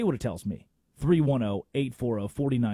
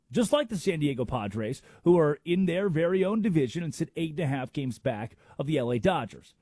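normal talking voice

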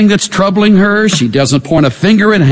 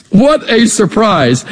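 slow words through a real chuckle; laughing; 4 semitones higher, slower than usual. Real, not acted. Preacher laughs at the surprise, amused. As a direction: let the laugh spill into it.

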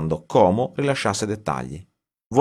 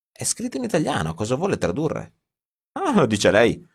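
incredulous protest; 6 semitones higher than usual. Acted. Writer protests translating Italian.

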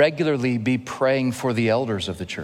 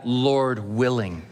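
slow, weighty "Lord willing"; slower than usual. Shown as a demonstration. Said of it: "then we add".

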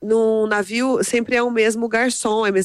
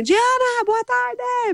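very high, stretched-out greeting call; mock yell back to a small child; imitated cheerful yell; much slower than usual. School guard calling back to the little girl, imitated high yell. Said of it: "ele grita pra ela também".

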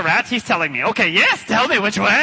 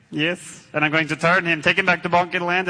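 ordinary talk

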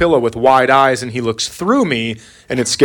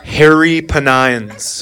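drawn-out, deadpan "Hairy penines"; dad reading the search aloud; slow reading; much slower than usual. Shown as a demonstration. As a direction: read the typo slowly. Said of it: "read off the browser history".

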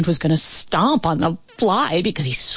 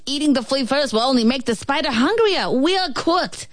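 fast and frantic; 7 semitones higher than usual. A narrator's reading. Ant frantic.

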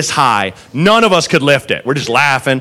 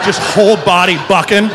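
ordinary talk

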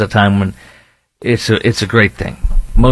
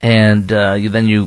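ordinary talk, nothing marked

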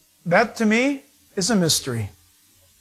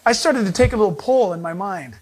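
usual speaking voice

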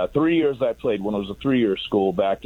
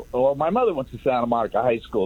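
normal talking voice

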